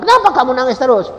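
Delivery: loud, high fast asking; loud concerned question; loud quick question. Retold or imitated. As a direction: press her why she cries. Preacher asks crying woman.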